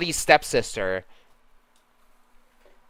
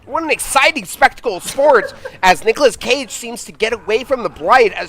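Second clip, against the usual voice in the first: sportscaster voice; 4 semitones higher than usual. Acted. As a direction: hype up the game like a TV sportscaster. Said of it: "the sportscaster voice".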